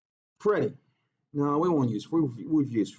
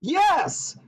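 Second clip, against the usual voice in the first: excited yes; 12 semitones higher, 3 dB louder than usual. Pretend.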